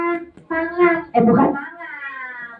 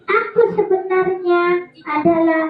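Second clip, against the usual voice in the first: cheeky puppet; 9 semitones higher than usual. Acted. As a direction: announce yourself as a cheeky puppet. Puppet introduces itself.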